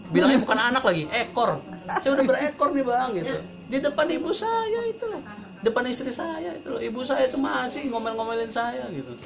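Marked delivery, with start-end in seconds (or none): 1.9-3.1 high-pitched mock grievance; complaining husband. Father of three confiding in an older brother, aggrieved. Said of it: "curhatan orang tua kayak gitu". 3.7-8.8 very high mock wail; complaining husband. Husband scolded by his mother before his wife, plaintive. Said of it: "curhatan orang tua kayak gitu".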